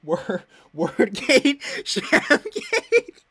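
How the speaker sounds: loud, slow, then squealing high; loud mock-scandal cry rising to a squeal. Real, not acted. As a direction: announce a fake scandal gleefully.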